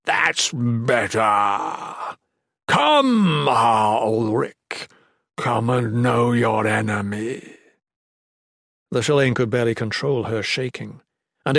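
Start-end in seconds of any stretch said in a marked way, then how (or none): 0.0-7.5 very slow, drawn-out monster voice; gloating monster villain. A narrator's reading. Monster summoning Ulrich to face him, slow gloating menace. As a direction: beckon the enemy with slow menace.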